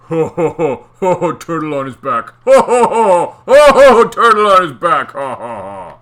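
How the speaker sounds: loud mocking ho ho; mean teasing chant; mocking taunt. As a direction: jeer at the turtle. Eel mocking laugh.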